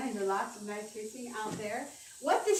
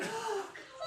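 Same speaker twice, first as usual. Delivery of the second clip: big yawn; 11 semitones higher, 7 dB quieter than usual. Shown as a demonstration. Coach leads the class in big yawns, gasping. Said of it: "We're gonna do some big yawns".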